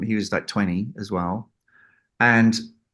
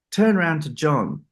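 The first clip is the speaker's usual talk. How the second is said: normal talking voice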